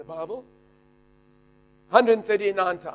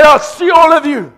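triumphant shout; 6 semitones higher, 10 dB louder than usual. Retold or imitated. Preacher shouting goodbye to the ward. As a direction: shout it as you sweep out.

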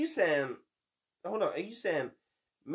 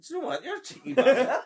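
laughing tease; 12 semitones higher, 7 dB louder than usual. Real, not acted. Teasing friend, laughing.

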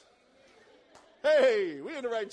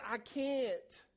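whiny quitter; 5 semitones higher, much slower than usual. Retold or imitated. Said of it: "when somebody says".

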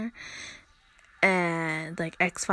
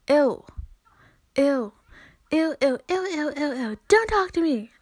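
higher, louder grossed-out ew, ew, ew; disgusted yelling; 6 semitones higher, 5 dB louder than usual. Real, not acted. Fed up with strangers, she yells in disgust. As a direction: shove them away with a loud, high ew. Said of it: "You may think I'm yelling".

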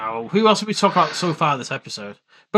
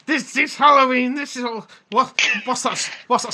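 mock pompous Jack; 7 semitones higher than usual. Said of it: "He's like".

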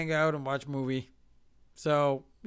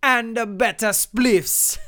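slow, loud Jamaican punchline; Jamaican accent; 5 semitones higher, 8 dB louder, slower than usual. Retold or imitated. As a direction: draw it out loudly in a Jamaican accent. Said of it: "then he said to me".

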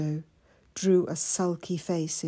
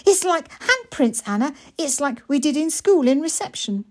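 high and louder, bubbling over; excited little boy; 8 semitones higher, 6 dB louder than usual. Acted. Mikey pointing out the marks to Anna, excited. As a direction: blurt it out like an eager child.

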